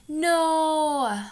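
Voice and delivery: high, loud Utah "No"; imitating sister's Utah no. Mimicking her sister's Utah accent, high loud No. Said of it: "She goes".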